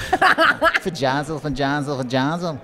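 slightly nasal Essex chant; put-on nasal Essex; nasally Essex accent. Shown as a demonstration. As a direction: repeat it nasally, Essex-style. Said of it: "nasally way".

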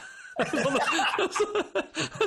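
squealing laugh; high laugh. Real, not acted. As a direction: shrieks of laughter. Laughs at Alfie's story.

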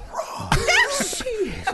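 high, disbelieving squeak; surprised. Retold or imitated. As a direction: voice jumping up in shock. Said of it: "I'm like".

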